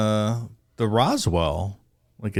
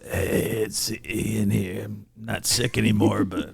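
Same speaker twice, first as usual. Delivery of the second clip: mock raspy Ian. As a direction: put on a sick rasp. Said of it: "some voice changer that simulates this".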